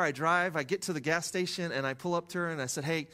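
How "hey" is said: ordinary talk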